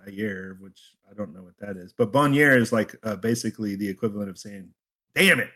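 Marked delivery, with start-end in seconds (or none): 5.2-5.5 high, loud mock curse; exclaimed curse. Shown as a demonstration. Co-host explaining a French expression, demonstrated loud exclamation. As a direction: punch it out as a sudden exclamation.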